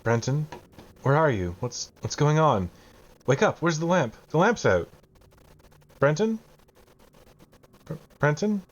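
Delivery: put-on high-pitched child's voice, calling into the dark; worried little child asking for Brenton; small child. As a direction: repeat the lost child's questioning calls, pitched high. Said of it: "what's the voice saying again?".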